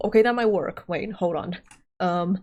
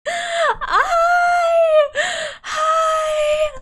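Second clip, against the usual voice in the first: much higher, shouted hi; excited greeting; 12 semitones higher than usual. Real, not acted. Streamer cheering hello to her chat on return. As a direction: burst out with joy at being back.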